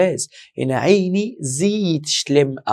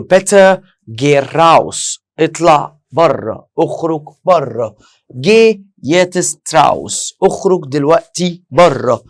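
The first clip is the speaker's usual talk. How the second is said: loud, slow teacher scolding a noisy pupil; telling a student off; 2 semitones higher, 7 dB louder, slower than usual. Retold or imitated. Teacher shouting at a chatty student to get out. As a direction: order him out of the class sharply. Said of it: "واحد عمال يتكلم بصوت عالي في الفصل".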